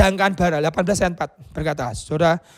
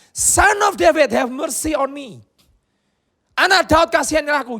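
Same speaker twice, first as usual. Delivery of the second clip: high, loud plea; crying out; 8 semitones higher, 4 dB louder than usual. Retold or imitated. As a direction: call out desperately for mercy. Bartimaeus crying out to Jesus.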